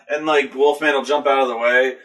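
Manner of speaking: normal talking voice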